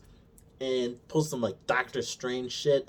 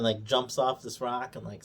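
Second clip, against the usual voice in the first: ordinary talk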